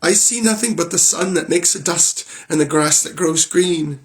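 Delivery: high voice of the younger sister calling down; sister Anne. Acted. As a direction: report from the tower, plainly disappointed.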